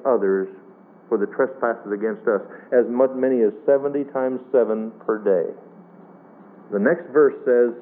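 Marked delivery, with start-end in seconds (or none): none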